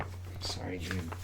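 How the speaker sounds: apologising to the creature in a whisper; whispered apology. Retold or imitated. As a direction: breathe it out like a sympathetic aside. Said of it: "I'm like".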